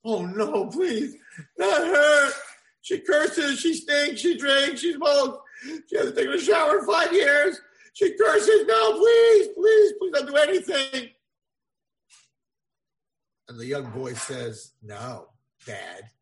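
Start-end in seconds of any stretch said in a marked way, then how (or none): 0.0-11.1 high, loud frantic begging; desperate pleading. Acted. Young man begging father in high panicked cries. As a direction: beg father not to marry him off, high and loud.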